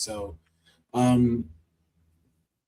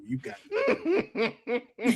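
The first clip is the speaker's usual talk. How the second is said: laughing; 8 semitones higher, 8 dB quieter, slower than usual. Real, not acted. Laughing while repeating it.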